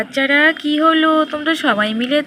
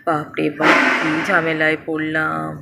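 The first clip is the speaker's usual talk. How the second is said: low, grown-up father voice, a bit harried; deep fatherly voice muttering about the hassle; 9 semitones lower than usual. Acted. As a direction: speak as a busy dad talked into an errand. Father character voiced in story.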